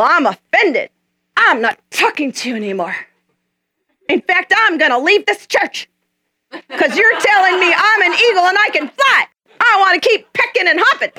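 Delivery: high-pitched mock huff, laughing through it; huffy mock indignation. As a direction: play the offended churchgoer for laughs. Offended eaglet storms off huffily.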